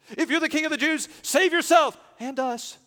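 loud, high, urgent shout; selfish demand. Acted. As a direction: cry out as the crucified man demanding rescue. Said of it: "his prayer was a little more selfish".

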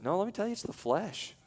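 ordinary talk, nothing marked

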